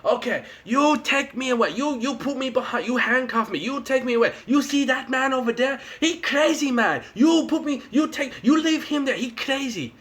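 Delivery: put-on high, broken-English accent; hammed-up Japanese accent; mock Japanese accent. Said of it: "this Japanese guy is like".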